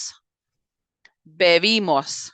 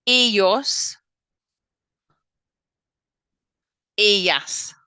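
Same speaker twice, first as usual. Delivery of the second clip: repeat-after-me drill; 2 semitones higher, slower than usual. Shown as a demonstration. Teacher drilling Spanish pronouns for the class, repeat-after-me delivery. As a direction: separate words with long pauses. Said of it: "Repeat after me".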